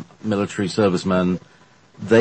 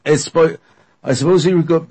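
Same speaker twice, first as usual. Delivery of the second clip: higher-pitched West Country demo; West Country accent; 7 semitones higher, 3 dB louder than usual. Shown as a demonstration. Host asked to show police speech, West Country accent. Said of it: "let's hear a little".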